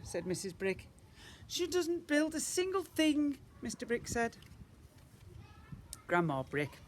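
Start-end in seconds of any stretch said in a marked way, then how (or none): 1.5-3.4 high, slow complaint; a hint of a despairing dad; fretful father. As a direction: whine it out in despair. Father's quote.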